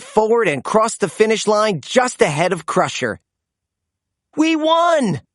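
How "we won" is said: high joyful cheer. A narrator's reading. Blaze and AJ winning the race, high cheer. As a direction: cheer the win. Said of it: "Blaze and A J cheered".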